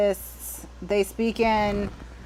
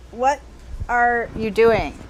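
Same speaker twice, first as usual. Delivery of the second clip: slow, deliberate disbelief; slow emphatic; slower than usual. Acted. Reader scolding the absent author, slow and deliberate. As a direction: spell out each word to the author. Repeats the question slowly.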